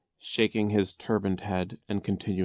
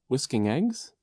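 higher, rising doubtful echo; suspicious; 5 semitones higher than usual. A narrator's reading. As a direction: repeat it warily as a question. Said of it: "Klaus said suspiciously".

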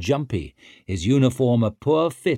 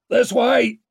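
high, loud shout; full-voiced directing yell; shouted call; 14 semitones higher, 6 dB louder than usual. A narrator's reading. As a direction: call out to riders through the storm.

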